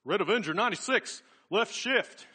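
high, quick, loud quarterback-style call; muttered play-calling. Retold or imitated. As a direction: fire off urgent football instructions. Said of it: "he was muttering what seemed to be instructions".

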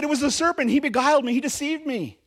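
high, loud pleading excuse; blaming Eve. Acted. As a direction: shift the blame in a high pleading voice. Eve blames the serpent.

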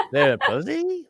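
"pussy" lifted at the end like a question; uptick at the end. Shown as a demonstration. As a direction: let the voice tick upward on "pussy". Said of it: "like, a, like, a up, uptick".

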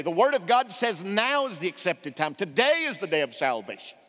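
ordinary talk

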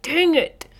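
mock frustration. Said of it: "And I'm like".

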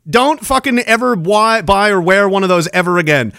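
ordinary delivery

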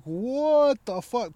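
drawn-out, high-pitched disbelief; stunned reaction. Retold or imitated. Retelling his tumble to a friend, stunned and drawn out.

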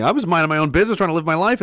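ordinary talk

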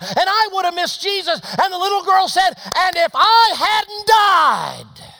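high, shouted sermon climax; full-force preaching. Real, not acted. Preacher tells what the healed are grateful for, full-force preaching. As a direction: preach at the top of the voice, driving it hard.

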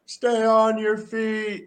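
high, drawn-out coach command; coach's call. Retold or imitated. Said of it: "calf is".

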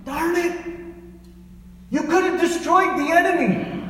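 high, frustrated outburst as the prophet; retold angry rebuke, voiced high. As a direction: scold the king for stopping short. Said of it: "and he gets angry".

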